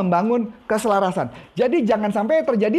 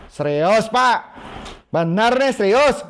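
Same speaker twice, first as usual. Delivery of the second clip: mocking tone; slower than usual. Shown as a demonstration. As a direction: say it like you're making fun of him. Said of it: "ini tentu kelihatan ngeledek ya".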